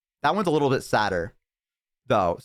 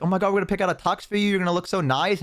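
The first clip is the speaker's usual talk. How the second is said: excited mom; 7 semitones higher, faster than usual. Retold or imitated. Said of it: "She's like".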